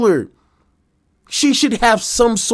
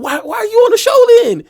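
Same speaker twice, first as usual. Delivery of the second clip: higher, faster exasperated disbelief; incredulous outburst; 12 semitones higher, 4 dB louder, faster than usual. Real, not acted. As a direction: fire it off in stunned disbelief. Streamer reacts in disbelief.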